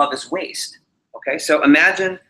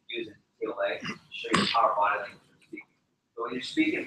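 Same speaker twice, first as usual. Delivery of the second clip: whisper; 4 semitones lower, 8 dB quieter, faster than usual. Real, not acted. Drops to a whisper.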